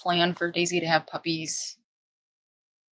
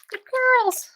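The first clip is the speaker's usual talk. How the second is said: squeaky puppy praise. Real, not acted. Praising puppies, jumps high.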